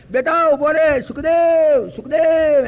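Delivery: high, loud drawn-out call. Acted. Vyas calls after his fleeing son Sukhdev, shouting. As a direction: shout after a runaway son, stretching the name. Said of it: "એમ બૂમો પાડે".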